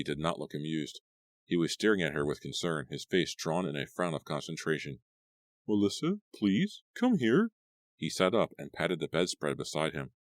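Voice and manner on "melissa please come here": higher, gentle plea from Buddy; a hint of a worried young man; lightly voiced boyfriend. A narrator's reading.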